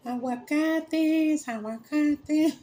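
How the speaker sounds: high, slow, stretched-out hawking cry; drawn-out high vendor shout; street vendor call. Retold or imitated. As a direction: call it across the church like a market boy. Pepito hawking inside the church.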